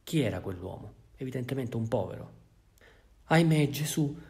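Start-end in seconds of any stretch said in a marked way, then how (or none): 3.3-4.2 high, loud anxious lament; fretting housekeeper. Acted. Housekeeper frets to the bishop about the silver, loud lament. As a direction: wail it like a worried old servant.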